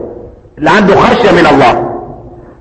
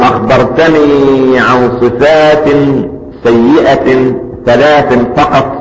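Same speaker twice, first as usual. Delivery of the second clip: calm reply; 6 semitones lower, slower than usual. Acted. Hasan al-Basri replies calmly.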